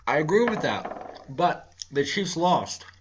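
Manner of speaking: chewing on ice while talking; mouth full. Real, not acted. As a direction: talk around a mouthful of ice. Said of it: "sorry, I'm chewing on ice".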